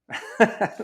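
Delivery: airy laugh at himself; breathy laugh. Real, not acted. A coach laughing at his own rant. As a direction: laugh off the outburst under the breath.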